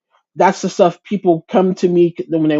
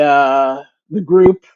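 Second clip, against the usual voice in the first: usual speaking voice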